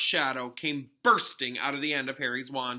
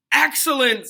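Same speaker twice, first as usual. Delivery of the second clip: high, loud praise; teacher praising the boy, delighted; 11 semitones higher, 12 dB louder than usual. A narrator's reading. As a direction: cheer him on warmly. Lupin praises Harry.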